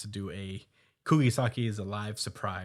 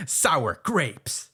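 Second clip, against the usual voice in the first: teasing through laughter, a bit higher; laughing mockery; 4 semitones higher, 5 dB louder, much slower than usual. Real, not acted. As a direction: laugh out the jab at the loser.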